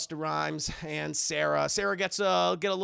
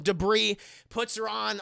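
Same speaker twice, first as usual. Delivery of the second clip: usual speaking voice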